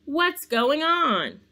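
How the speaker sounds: high, slow, drawn-out demand; startled cry. A narrator's reading. Santa straightens his hat, high startled cry. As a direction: call it out bewildered. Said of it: "cried Santa".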